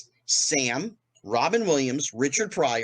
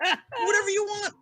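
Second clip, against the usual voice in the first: much higher, put-on voice, cheering him on; Richard Pryor egging him on. Richard Pryor urging the doorman onto stage, squeaky high voice. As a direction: hype up your buddy in a squeaky, strained voice. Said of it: "and he goes".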